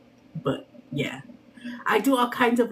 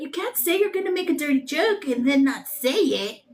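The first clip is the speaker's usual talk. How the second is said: usual speaking voice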